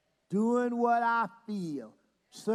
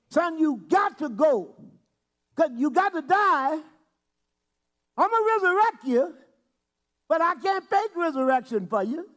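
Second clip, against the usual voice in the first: louder, higher, insistent Father; loud preaching Father's orders; firm command; 6 semitones higher, 5 dB louder than usual. Retold or imitated. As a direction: drive it home as a stern Father.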